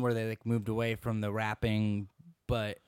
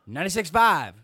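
belted frequency, much louder; loud station call; 4 semitones higher, 13 dB louder than usual. Acted. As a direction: shout the frequency like a jingle.

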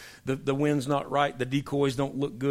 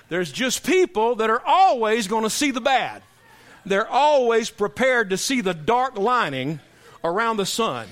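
raised, louder pulpit voice, driving the point home; preaching at full force; 7 semitones higher, 6 dB louder than usual. Acted. Preacher warning the church about faith-draining people, louder and raised. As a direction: preach it hard to the congregation. Preaching again after the joke.

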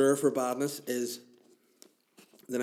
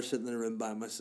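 ordinary talk

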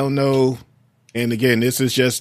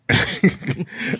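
short unvoiced laugh; breathy laugh; 7 semitones higher than usual. Real, not acted. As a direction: let out a quick airy laugh. Laughs at own emphasis.

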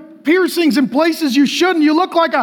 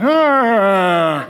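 low, long "Oh" sliding downward; mock a joyless reading with a drooping "Oh"; unimpressed atheist "Oh"; 7 semitones lower than usual. Shown as a demonstration. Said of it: "That's how atheists read Romans eleven".

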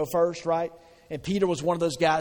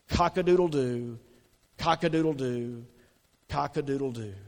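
rooster crow. Retold or imitated. Preacher imitates the rooster crowing.

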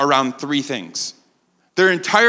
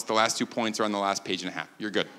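quiet aside; 9 semitones lower, 10 dB quieter, faster than usual. Real, not acted. Aside promising a quick finish.

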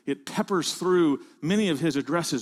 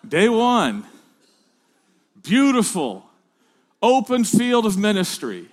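bright, rising, louder announcer build-up; mock triumphant; 3 semitones higher, 6 dB louder than usual. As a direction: hype up the fresh start like a trailer. Mock triumphant start of ministry.

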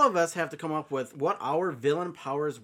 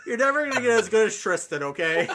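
mock scolding; 7 semitones higher, 8 dB louder than usual.

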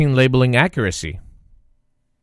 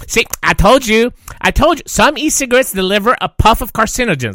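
high, loud nagging aunt voice; 8 semitones higher, 4 dB louder than usual. Retold or imitated. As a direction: scold like an I-told-you-so aunt. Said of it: "That's my aunt voice".

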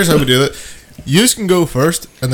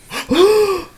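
disgusted gasp. Real, not acted. Reaction to tasting whiskey.

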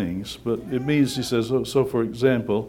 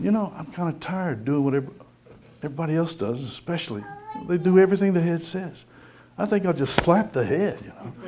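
lightly voiced whiny hand, higher; a grumbling hand that wants to slap the head, pitched up; voice of a tired hand; 6 semitones higher than usual. Preacher voicing a rebellious hand to the congregation, a hint of whine. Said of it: "my hand is sitting there going".

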